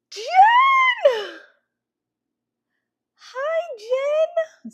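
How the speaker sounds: very high, excited squealed greeting; overexcited squeal. Real, not acted. Host greeting Jen on her live show, overexcited squeal. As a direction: squeal the name with real excitement. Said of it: "Sorry, I got too excited".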